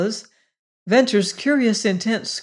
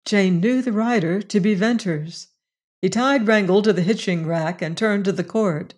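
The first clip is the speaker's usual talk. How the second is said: ordinary delivery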